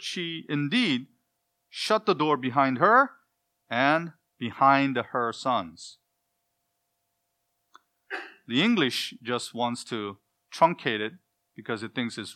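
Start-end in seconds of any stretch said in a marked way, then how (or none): 0.0-6.0 slow, weighty delivery of "behind her sons"; slow emphasis. Shown as a demonstration. Preacher stresses her strict obedience, slowly. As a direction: lean on each word slowly to press the point. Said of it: "The two emphatic statements are repeated".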